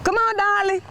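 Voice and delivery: high, loud coaxing call. Real, not acted. Crossing guard waving a driver through, loud coaxing call. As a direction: urge the car along.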